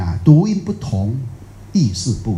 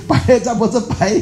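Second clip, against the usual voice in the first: laughing while talking; 9 semitones higher than usual. Real, not acted. Joking about cataracts.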